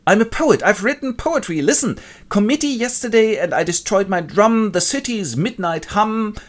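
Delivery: high, declaiming poet voice; Helmholtz the poet. Acted. Helmholtz shares his poetry with John, high declaiming.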